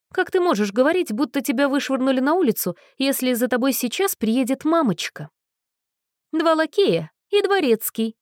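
high, teasing, lightly scornful; mocking Anna. Anna teases the weeping girl from the window, lightly mocking. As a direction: tease her from above.

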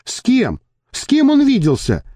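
high, insistent woman's questions; impatient interruption. A narrator's reading. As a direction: butt in impatiently, pitch jumping up. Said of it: "нетерпеливо вмешалась Мириам". (нетерпеливо вмешаться)

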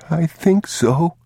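put-on slow, high child's voice; small, unsure little boy; young boy. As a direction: answer high and hesitant, like a child. Terrified boy answers his father.

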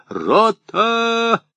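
a sharp high military command; commanding shout. Acted. Officer calls the company. (громкая команда)

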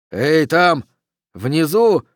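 shouting. Acted. Oxy hails the figures down below, shouting. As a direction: hail people far below. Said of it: "кричал Окси".

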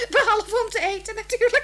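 laughing through the words, high and loud. Acted. Wife jokes that her husband only opens his mouth to eat, laughing high and loud. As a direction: laugh the punchline out.

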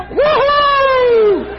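long high-pitched whoop; whooping shout. Acted. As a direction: let out a high, ringing "woo-hoo". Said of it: "el pueblo tenía que gritar".

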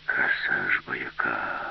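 low, slow sighed admiration; wistful sigh. A narrator's reading. Old robot sighs at the beauty, low and wistful. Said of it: "зітхнув раптом старий".

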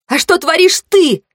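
high, indignant counter-question; accusing. A narrator's reading. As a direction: throw the accusation back, pitched high. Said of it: "Я обвинительно ткнула в него пальцем". (обвиняюще, высоким голосом)

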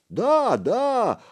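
high-pitched chorus of yes-men; animated henchmen. A narrator's reading. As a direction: pipe up as eager sidekicks. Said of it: "оживились его шестёрки".

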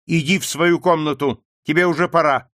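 sharper, commanding; imperious order. Acted. Wife ordering her husband to his room, imperious. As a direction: send him off like a servant. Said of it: "повелительно произнесла маркиза".

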